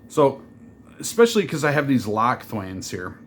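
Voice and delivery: ordinary delivery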